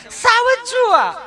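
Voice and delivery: very high, slow mock whine; mimicking city lady. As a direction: stretch it out high and fussy. Mimics tourist woman.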